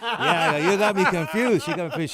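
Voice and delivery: laughing through the words. Real, not acted. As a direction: giggle through it. Laughing reply.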